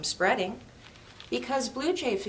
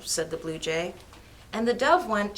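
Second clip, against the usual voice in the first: usual speaking voice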